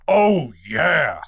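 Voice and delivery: put-on punchy catchphrase voice; Kool-Aid Man. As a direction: imitate the big cartoon pitcher. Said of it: "like the Kool-Aid Man".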